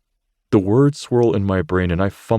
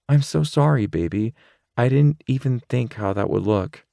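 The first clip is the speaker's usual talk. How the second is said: put-on higher girl's voice, softening; Rachel, sorry; 6 semitones higher than usual. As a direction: she apologises to her boyfriend. Rachel apologises to Zane.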